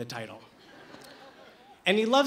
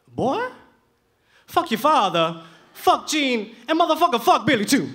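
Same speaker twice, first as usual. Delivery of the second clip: much louder, higher, rising blunt rant; Patrick's outburst; 7 semitones higher, 7 dB louder than usual. Retold or imitated. Patrick telling the narrator off, loud gruff rant. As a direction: blast it like a gruff mentor. Patrick scolding him loudly.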